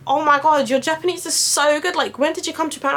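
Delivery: high, fast gushing surprise; over-the-top impressed compliment; mock-amazed gushing; amazed praise. Japanese people praising.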